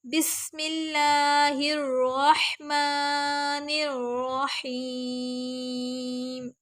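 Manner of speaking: slow unbroken recitation in one breath; flowing recitation. Shown as a demonstration. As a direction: chant it smoothly without a break. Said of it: "را بالکل بھی لمبا نہ ہو". (ایک سانس میں روانی سے تلاوت)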